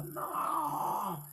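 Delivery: very high, drawn-out wail; imitated screaming. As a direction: imitate a spirit wailing as it is cast out. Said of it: "imagínense que estuviera gritando". (grito agudo y lastimero, imitado)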